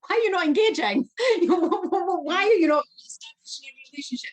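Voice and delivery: high, loud, laughing mock demand; pressing her staff, half laughing at herself; mock exasperated challenge. Coaching her staff, she fires the question loud, high and laughing.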